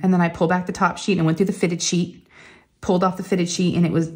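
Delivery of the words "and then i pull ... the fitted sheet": ordinary talk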